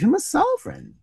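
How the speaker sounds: dismayed cry. A narrator's reading. Hughie realising he tipped a baron, dismayed cry. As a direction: cry out as the blunder sinks in. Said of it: "cried Hughie".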